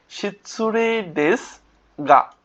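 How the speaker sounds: শিৎসু রে দেশ গা drawn out, with গা set apart; word by word. Shown as a demonstration. Teacher building the phrase for learners, word by word. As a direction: slowly separate each part of শিৎসু রে দেশ গা. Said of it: "উচ্চারণটা কি হবে?".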